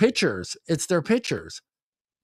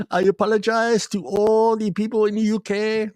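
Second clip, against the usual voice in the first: put-on Indian accent; 6 semitones higher than usual. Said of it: "that was my Indian accent".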